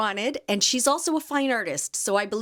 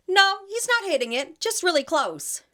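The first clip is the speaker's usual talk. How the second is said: higher, airy brush-off in a put-on lady voice; mock dismissive lady; 10 semitones higher than usual. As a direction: play the dog owner waving it away, pitched up. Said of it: "she goes".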